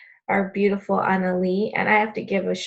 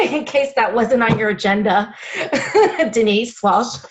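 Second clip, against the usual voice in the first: louder, through real laughter; laughing tease; 3 semitones higher, 5 dB louder than usual. Real, not acted. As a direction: laugh as you nudge the boss by name. Laughing tease aimed at Denise.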